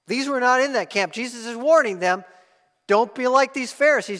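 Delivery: loud, high pulpit warning; full-force sermon voice; fiery preaching. Acted. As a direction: drive the warning home.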